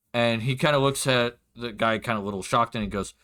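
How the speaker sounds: ordinary delivery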